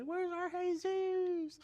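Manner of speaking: high, very drawn-out call; little old lady. Retold or imitated. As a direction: wail it out like an old lady searching. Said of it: "This little old lady right here just like".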